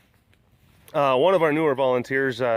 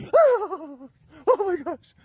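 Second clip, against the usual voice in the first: mock fan squeal; much slower than usual.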